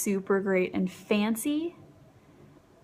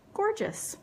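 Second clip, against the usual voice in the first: excited; 10 semitones higher than usual. Real, not acted. First reading of the shade name.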